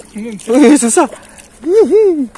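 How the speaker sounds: loud, very high yelps; excited squealing. Real, not acted. Angler struggling to land a big fish, squealing. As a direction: squeal while wrestling the catch.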